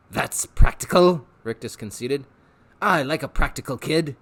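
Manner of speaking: eager, wheedling showman; a salesman tempting the boy; Rictus voice. Acted. Rictus tempting Harvey with pets, eager showman voice.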